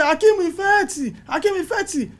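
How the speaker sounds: "Akeem Ifeti" twice, high-pitched, fading at the end; imitating the call. Crew calling his nickname across set, high and fading. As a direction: echo the crew's high repeated call. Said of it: "So people will now say".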